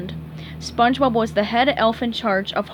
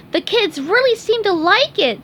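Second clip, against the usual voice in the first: SpongeBob voice; 9 semitones higher, 5 dB louder than usual. Acted. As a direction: call out like a cheery head elf.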